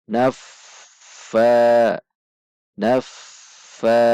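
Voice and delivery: "Naffa" dengan desir f panjang mengalir; f tidak tertahan. Shown as a demonstration. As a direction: alirkan f ganda "Naffa" tanpa putus, fa dipanjangkan. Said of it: "dibaca tidak tertahan". ("Naffa" lambat, fa panjang dan lebih keras)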